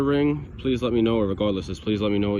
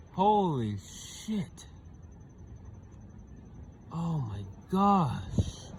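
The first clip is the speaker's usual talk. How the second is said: very slow, hushed amazement; soft, drawn-out, genuinely floored; stunned disbelief; 4 semitones higher, 7 dB quieter, much slower than usual. Real, not acted. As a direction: stretch each word out softly in awe. Stunned by a rare coin find.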